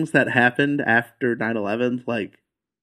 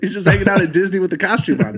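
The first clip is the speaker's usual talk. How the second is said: laughing; 6 semitones higher, 3 dB louder, faster than usual. Real, not acted. Laughing through the joke.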